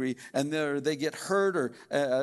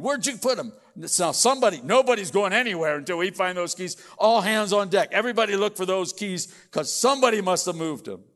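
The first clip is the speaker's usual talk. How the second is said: loud and higher, half-acted panic; hammed-up angry search for keys; mock frantic yelling; 5 semitones higher, 7 dB louder than usual. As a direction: bark orders at the whole house as if the keys are lost. Acted key hunt yelling.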